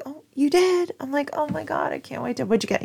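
gushing at a promised surprise, high-pitched; excited. Retold or imitated. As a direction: re-enact giddy anticipation, voice way up. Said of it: "I am immediately excited".

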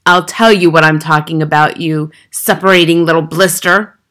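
loud, venomous retort; Trunchbull's shout. A narrator's reading. Headmistress rounds on the puzzled boy, shouting. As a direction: shout the insult straight at him. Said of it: "the Trunchbull shouted".

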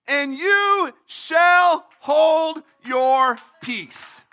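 very slow and deliberate; slow, drawn out. Acted. Preacher reading the verse, very slow. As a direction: stretch every word out. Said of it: "reading verse fourteen".

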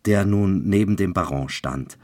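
ordinary delivery

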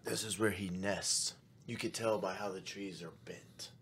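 hushed, breathy ghost-hunter whisper, dropping to nothing; mock show whisper. Parodying Finding Bigfoot hosts to his cohost, whispered. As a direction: whisper it like a TV host stalking Bigfoot.

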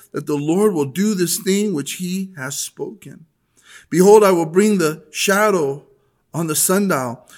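measured, solemn Bible reading voice; scripture reading. Acted. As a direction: read the passage out to the church with weight. Reads scripture aloud to congregation.

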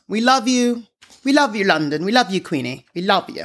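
badly faked British accent; attempted British accent. Host declaring love to London and the Queen, attempted British accent. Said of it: "if that was a good accent".

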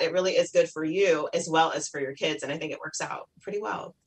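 ordinary delivery

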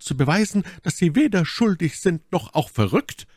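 yüksek perdeden kadın sesi; high-pitched, impassioned Milady. A narrator's reading.

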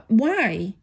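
ordinary talk, nothing marked

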